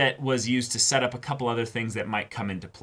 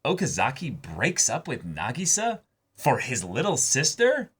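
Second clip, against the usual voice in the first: incredulous surprise; 3 semitones higher than usual. Real, not acted. Shocked by the plot twist.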